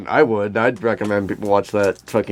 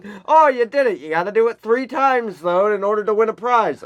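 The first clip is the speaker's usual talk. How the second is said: carnival barker; 10 semitones higher than usual. Retold or imitated. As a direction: bark it out to a passing crowd. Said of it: "Carnival Barker".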